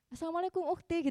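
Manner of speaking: lilted greeting, voice lifted high; imitated catcaller. Men calling out to women walking past, mimicked catcall. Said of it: "ada juga yang bahkan".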